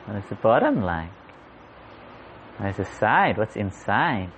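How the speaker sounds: lightly voiced wondering child, each question swooping up on the key word; curious child. Child checking out a strange shell on the beach, curious wondering. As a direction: wonder aloud like a child inspecting a find. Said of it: "Like a child checking out".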